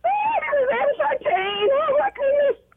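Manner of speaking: very high, thrilled squeal of joy; squealing with excitement. Real, not acted. Radio caller hearing her name, squealing excitedly. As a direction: shriek it like you just won.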